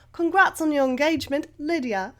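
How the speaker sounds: amateur put-on English accent; best English accent. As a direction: read it out in a playful attempt at English. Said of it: "in my best English accent".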